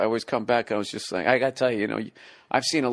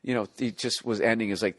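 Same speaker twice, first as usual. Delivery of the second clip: ordinary delivery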